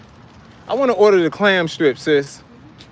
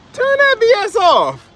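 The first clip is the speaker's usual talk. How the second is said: laughing yell; 7 dB louder than usual. Real, not acted. Annoyed at blasting music.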